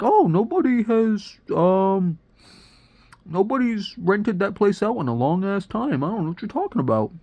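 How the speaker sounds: high-pitched put-on phone agent; airy high voice, denying any knowledge; agency person voice. Rental agent answers his call, high put-on voice.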